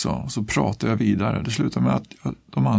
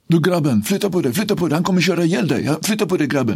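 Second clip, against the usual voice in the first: retold shouting; 7 semitones higher than usual. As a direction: yell at him to get off the path. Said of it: "Då ropar de här". (ropande varning)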